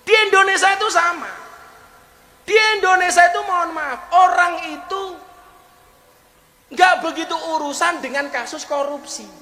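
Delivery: high, loud sermon delivery; rousing pulpit preaching; fiery preaching. Acted. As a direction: preach it at full force to the crowd. Preaching at full force.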